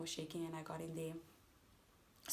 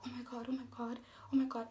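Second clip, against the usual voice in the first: imitating a traumatized woman; 6 semitones higher, faster than usual. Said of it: "I can hear this lady talk to him and says".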